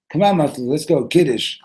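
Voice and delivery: loud, high hurry-up; impatient urging. Acted. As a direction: push him to get on with it. Urging friend after interruption.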